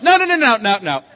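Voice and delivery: mock hasty refusal. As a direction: imitate a friend hurriedly backing out. Friend hastily refuses the Hebrew Bible.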